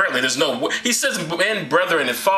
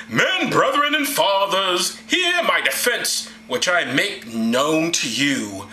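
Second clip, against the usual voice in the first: Paul orating; much slower than usual. Acted. Paul on the stairs to the people, grandly oratorical. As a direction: stretch each word like a grand orator. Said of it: "this is Paul now".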